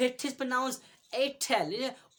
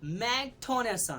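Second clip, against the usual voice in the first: normal talking voice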